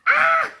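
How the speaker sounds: high, loud squeal of delight; a fan's sudden shriek; excited shriek. Real, not acted. Reacting to a photo card. (cri aigu de fan ravie)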